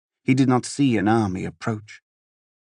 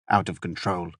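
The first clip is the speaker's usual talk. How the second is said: ordinary delivery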